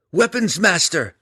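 loud, high, elated call; crying out. A narrator's reading. Drizzt greeting his father with elation, crying out. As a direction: call out joyfully to him. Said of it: "Drizzt cried".

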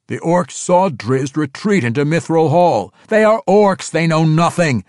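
a hint of stubborn desperate insistence; insisting. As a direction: press the point, refusing to doubt. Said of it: "Tiago insisted".

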